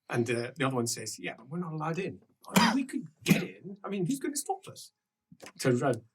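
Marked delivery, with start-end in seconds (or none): 1.2-4.9 softly voiced scheming; conspiratorial reply. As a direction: answer under your breath. Other guard answers in the huddle.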